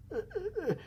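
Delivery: very high, tiny wordless whimpers; frightened stammer. Retold or imitated. Big bully facing the angry mom, tiny frightened stammer. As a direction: mimic helpless, speechless stuttering noises.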